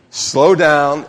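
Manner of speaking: loud, insistent prompt to read; leading a group reading. Real, not acted. Pastor repeats the point as people weren't reading, loudly. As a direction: belt it out so everyone joins in. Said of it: "cause you weren't reading".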